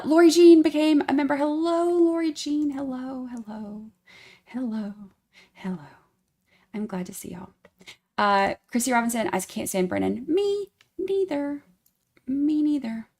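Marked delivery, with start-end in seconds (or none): 2.7-5.8 fading hello. Acted. Greeting fading away. 10.3-13.1 high, playful. Acted. Playful echo of chat.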